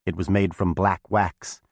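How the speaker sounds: plain reading voice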